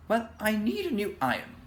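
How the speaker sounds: a housewife stating her need; old dame. A narrator's reading. Dame Washalot asking for an iron, lightly voiced old dame. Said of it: "said the old dame".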